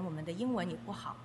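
normal talking voice

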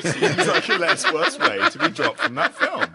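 high, slow, laughing delivery; talking through laughter. Real, not acted. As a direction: struggle the words out while cracking up. Laughing at the other's joke.

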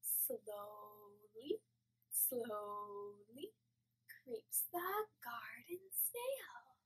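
very soft and slow, creeping like a snail; hushed slow singsong; gentle creeping whisper-song; slow soft snail verse. Shown as a demonstration. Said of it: "it goes like this".

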